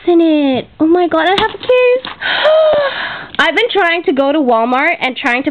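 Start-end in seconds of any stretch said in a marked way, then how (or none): 2.1-3.3 sharp high gasp of delight. Real, not acted. Vlogger opening crafting gifts gasps in delight. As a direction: gasp at the gift.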